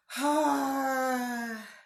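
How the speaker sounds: long, high, loud sigh; emotional sigh. Real, not acted. Host overwhelmed by missing her family, tearful sigh. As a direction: sigh hard to hold back tears. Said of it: "I'm about to cry".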